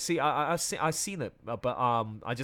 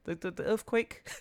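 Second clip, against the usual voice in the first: ordinary talk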